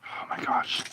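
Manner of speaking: very soft whisper. Real, not acted. Gamer whispering while sneaking past danger. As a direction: barely breathe the words. Tense moment in game.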